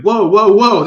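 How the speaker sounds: loud, high startled whoa; alarmed whoa. Retold or imitated. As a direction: call out in alarm. Alarmed at lost child.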